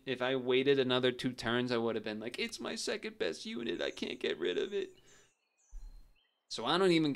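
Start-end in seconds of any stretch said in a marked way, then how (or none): 2.4-4.9 high-pitched helpless whine; mock whining. Player imagining being stuck with the ant, mock whine. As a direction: complain in a high, strained mock voice. Acted quote of frustrated self.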